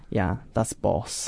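ordinary delivery

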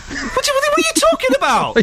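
very high-pitched, indignant squawk; hammed-up falsetto indignation; mock outrage. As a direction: protest the score in a squeaky high voice. Indignant at a low grunt score.

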